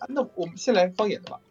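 usual speaking voice

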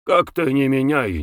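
usual speaking voice

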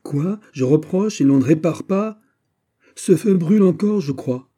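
loud, high commanding reproach; indignant rebuke. Acted. General scolds soldiers while the fire still burns, indignant. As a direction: shame them into acting at once.

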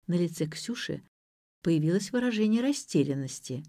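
ordinary delivery, nothing marked

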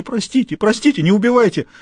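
a man on the floor begging the boss not to kill him; hysterical plea. A narrator's reading. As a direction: plead in panic. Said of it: "забился в истерике".

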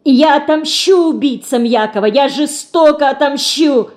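high, vehement vow of revenge; angry cry. A narrator's reading. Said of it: "Гневно кричит она". (гневный выкрик высоким голосом)

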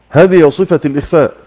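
ordinary delivery

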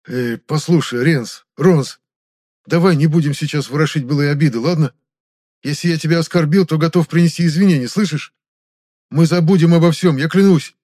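high, loud, anxious pleading; frantic placating. Acted. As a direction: talk fast-thinking excuses to save your skin. Captive pleads with his captor.

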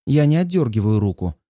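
ordinary delivery

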